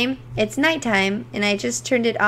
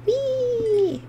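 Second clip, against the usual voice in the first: playful whee; 10 semitones higher than usual. Real, not acted. Playful while mining.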